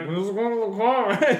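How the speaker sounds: high, slow, muffled innocent act; innocent kid, mouth full. Retold or imitated. Kid chewing stolen gummies, pretending innocence, high and slow. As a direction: play dumb with a mouthful of candy.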